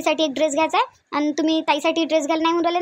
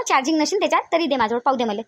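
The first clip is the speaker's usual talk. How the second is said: ordinary talk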